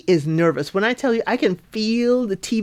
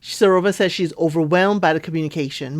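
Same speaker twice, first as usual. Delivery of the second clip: ordinary delivery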